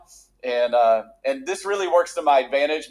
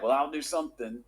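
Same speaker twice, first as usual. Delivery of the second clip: half-hearted; 2 semitones lower, 8 dB quieter than usual. Acted. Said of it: "just kinda like".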